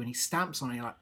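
ordinary delivery

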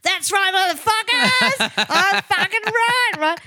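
high, loud, slow crowing at the Spanish guitarist; gloating triumph. Retold or imitated. Host reacting to the Graham Norton guest, triumphant high yell. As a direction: shout it like you just won the argument. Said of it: "And I'm like".

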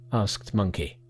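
ordinary delivery

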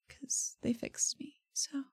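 barely voiced, slipping into a whisper; whispered confession. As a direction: drop to a hushed whisper, as if ashamed. Ella confides her debt quietly.

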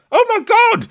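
very high, loud terrified cry; panicked shriek. Acted. Second villager shrieks in terror at the monsters. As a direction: scream it out in panic. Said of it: "someone else goes like".

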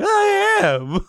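very high squeak on I am; squeaky drugged insistence. Retold or imitated. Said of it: "I'm like".

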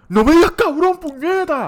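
hammed-up imitation of the offended friend; mocking imitation. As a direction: squeal it like an offended teenager. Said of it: "Eso a él no le gustaba".

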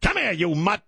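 high mock mobster growl; Pesci-style mobster. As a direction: go full Pesci on him. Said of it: "Starts going all Pesci".

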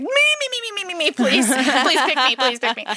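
very high, rapid-fire mock begging; eager pleading. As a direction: plead like you're desperate to be picked, half laughing. Acts out begging for the role.

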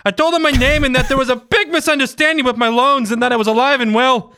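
high, lilting botched Bostonian; Boston accent turning Irish. Acted. Reader tells of calling the loan company, wobbly Irish-Boston accent. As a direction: let a bad Boston accent slide into Irish. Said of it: "we're getting a little Irish now".

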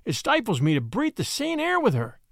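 flee her in horror; horrified. A narrator's reading. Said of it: "with a scream of horror".

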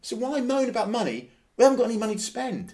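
high-pitched, rising to loud and fast; exasperated rant. Real, not acted. Podcaster answering moaning fans, high exasperated rant. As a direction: protest it in sheer exasperation. Ranting about fans' spending complaints.

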